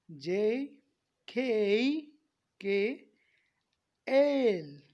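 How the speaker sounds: जे, के, एल with long gliding vowels; stretched letter names. Shown as a demonstration. Teacher modelling letters for students, slow stretched vowels. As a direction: drag out जे, के and एल separately. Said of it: "थोड़ा खींच के".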